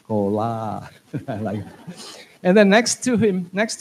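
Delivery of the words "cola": low Cola with open vowels, last syllable stressed; Thai-style Cola. Shown as a demonstration. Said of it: "Last syllable is very important".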